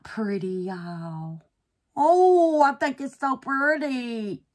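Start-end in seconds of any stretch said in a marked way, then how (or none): 2.0-4.4 loud, high, drawn-out real delight; delighted gushing. Real, not acted. Crafter admires her pretty handle to viewers, delighted. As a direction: gush over it, stretching the words.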